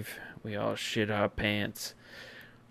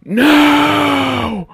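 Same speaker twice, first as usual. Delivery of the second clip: much higher, much louder anguished no; anguished yell; 15 dB louder than usual. Retold or imitated. Host to fans, imitating the crowd's anguished yell. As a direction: howl like watching your quarterback go down. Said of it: "we all went".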